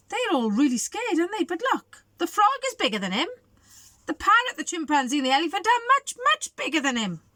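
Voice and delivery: ordinary delivery, nothing marked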